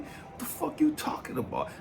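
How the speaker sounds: very high, incredulous mock protest. Man baffled by God's question, exasperated. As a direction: throw it back, squeaking up in disbelief. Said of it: "I'm getting more frustrated".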